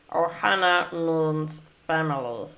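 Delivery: raspy alien creature voice, fading at the end; Stitch impression. Shown as a demonstration. Streamer doing her best Stitch for viewers, alien rasp. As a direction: become the little blue alien, dropping to a breathy trail-off. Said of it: "I'll do probably the classic line".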